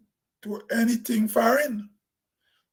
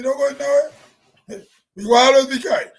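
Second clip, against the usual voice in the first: laughing; 3 semitones higher, 6 dB louder than usual. Real, not acted. Laughing at the same old problems.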